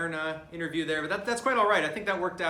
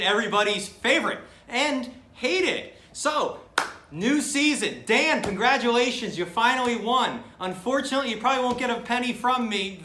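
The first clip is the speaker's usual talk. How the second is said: loud, higher-pitched sportscaster delivery; brash commentator; 6 semitones higher, 6 dB louder than usual. Acted. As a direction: punch it out like a hype commentator.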